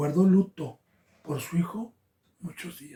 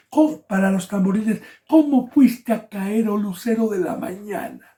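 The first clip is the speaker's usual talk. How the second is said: slow, loud, higher cry of grief; loud lament; 4 semitones higher, 5 dB louder than usual. Acted. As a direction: wail over the fallen angel, slowly. God lamenting the fallen cherub. (Dios lamenta al querubín caído, lamento lento y fuerte)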